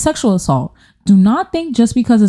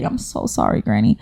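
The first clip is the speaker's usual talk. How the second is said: low mock apology; 10 semitones lower, 6 dB quieter than usual.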